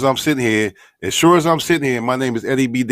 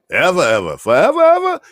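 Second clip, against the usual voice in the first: mock sing-song; 9 semitones higher, 5 dB louder than usual. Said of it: "mocking the claim".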